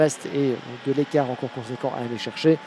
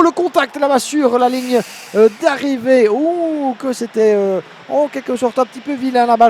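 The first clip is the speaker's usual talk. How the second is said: finish-line shout, higher and louder; excited commentator; 6 semitones higher, 7 dB louder than usual. Real, not acted. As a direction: cry out at the checkered flag.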